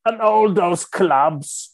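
mock-outraged British football man, high and very slow; irritated old chairman. Imitating the chairman's final complaint about club owners, hammed-up irritation. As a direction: stretch out each grievance like a fuming old character. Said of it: "han var riktigt irriterad".

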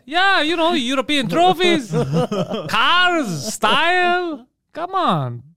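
high hammed-up Italian bragging; to friends, a high bragging cartoon Italian; mock Italian. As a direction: boast like a cartoon Italian charmer.

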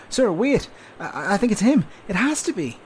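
high, loud, excited urgency; urgent excitement. Acted. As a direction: sure he's spotted the target. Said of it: "more urgent this time".

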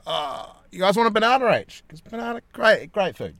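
high, bright salesman pitch; cheery dad voice. Retold or imitated. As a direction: sell the banana to the kids. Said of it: "and I'm like".

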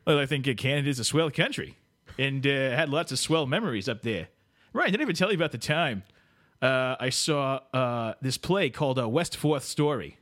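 ordinary delivery

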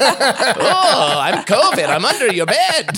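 high, slow, spooky ghoul voice; horror voice. Pretend. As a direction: play a creepy covid monster, laughing. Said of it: "You guys like my horror voice".

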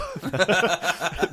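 big loud burst of laughter. Real, not acted. Cracking up at his own joke to the co-host, loud laughter.

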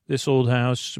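normal talking voice

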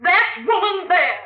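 high, slow, pointed accusation; accusing. Acted. Wife points out Laura in court.

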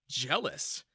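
plain reading voice

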